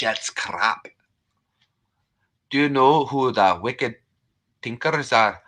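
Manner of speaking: put-on Scottish brogue; chatty mock-Scottish voice; Scottish accent. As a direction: play a proud Scot, thick accent. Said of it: "I've got to talk like I'm Scottish".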